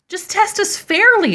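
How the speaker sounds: high, loud indignant cry; indignant exclaim. A narrator's reading. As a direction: demand fairness, outraged. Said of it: "they exclaimed".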